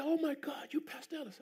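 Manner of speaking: high breathless whisper of awe; awed whisper. Retold or imitated. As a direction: whisper it like meeting a celebrity. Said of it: "He said".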